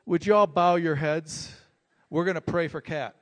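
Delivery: announcing a prayer to the checkout line; loud call to a crowd. Retold or imitated. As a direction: call out to everyone in the queue. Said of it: "and Anna says".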